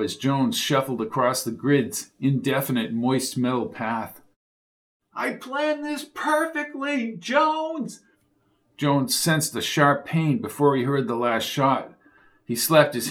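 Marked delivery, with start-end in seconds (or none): 5.1-8.0 high, slow, menacing gloat; gloating villain. A narrator's reading. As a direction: savor the triumph over Jones. Villain gloating while shooting.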